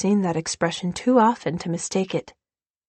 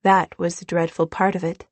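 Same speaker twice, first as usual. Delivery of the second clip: ordinary delivery, nothing marked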